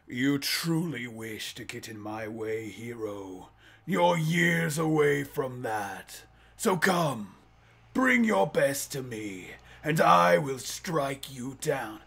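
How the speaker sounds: slow menace rising to a challenge; villain speech. Retold or imitated. Villain welcoming the hero to fight, slow and commanding. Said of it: "What if it really was".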